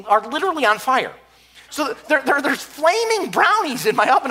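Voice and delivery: normal talking voice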